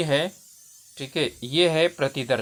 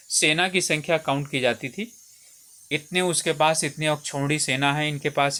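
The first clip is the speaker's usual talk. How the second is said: ordinary talk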